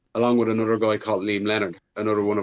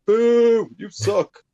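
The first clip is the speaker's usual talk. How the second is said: much higher and louder, a retold jeering crowd; booing crowd; 12 semitones higher, 6 dB louder, much slower than usual. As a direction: jeer like a crowd booing the bad guy. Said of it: "we're like".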